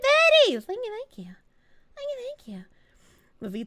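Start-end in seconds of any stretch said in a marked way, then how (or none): 0.6-1.4 a soft, higher thank-you. Real, not acted. Thanking chat, soft then whispered. 1.9-2.6 breathy whispered thank-you. Real, not acted.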